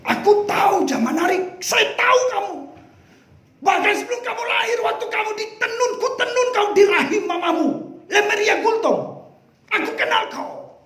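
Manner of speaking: high, ringing voice of God; God telling a woman he knew her before birth, fervent. Acted. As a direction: proclaim it as God speaking tenderly. Voicing God's words.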